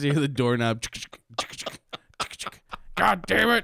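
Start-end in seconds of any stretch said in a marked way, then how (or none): 3.0-3.6 high mock exasperation; frustrated curse. Short ghost unable to reach the doorknob, mock frustrated. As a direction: curse in a strained, pitched-up voice.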